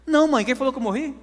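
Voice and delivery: high, incredulous retort; baffled protest. Retold or imitated. Son answering his shocked mother at the door, high and incredulous. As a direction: protest it with the voice pitched well up.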